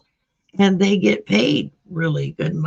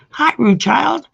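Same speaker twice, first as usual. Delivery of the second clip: bright greeting; 9 semitones higher, 5 dB louder than usual. Real, not acted. Greeting a new viewer.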